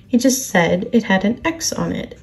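plain reading voice

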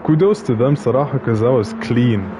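ordinary talk, nothing marked

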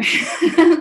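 loud, high embarrassed laugh; high burst of laughter. Real, not acted. Laughs about freezing outdoors.

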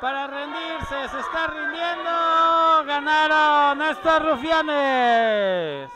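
loud rising call of the finish; excited shout. Real, not acted. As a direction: shout the win to the crowd. Commentator calls the win.

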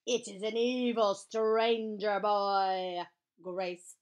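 slow, drawn-out loud alarm call; dramatic slow holler; shouting back. Acted. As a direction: stretch every word in a big shout. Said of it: "I shouted back".

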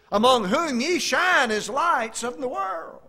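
slow, loud, high proclamation of the verse; preaching at full force. Acted. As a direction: lift the line up to the rafters. Scripture read at full force.

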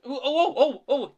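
high, loud run of startled "oh"; hurried, flustered. A narrator's reading. Thomas is startled mid-excuse, flustered. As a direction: yelp repeatedly in alarm. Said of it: "he went on hurriedly".

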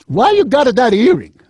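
high, loud granny complaint; grandmother voice. Retold or imitated. Dying grandma scolding grandson about earring, high granny voice. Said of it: "she said".

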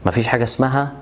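ordinary talk, nothing marked

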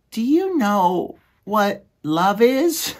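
very high, slow, dreamy squeak; lovestruck little mouse. Acted. Despereaux asking his brother about love, very high and dreamy. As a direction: float the question like he's in a daze.

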